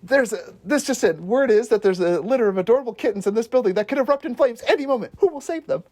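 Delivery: mock breaking-news anchor. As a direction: hype the story like a breathless reporter. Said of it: "Mac's anchor face and voice".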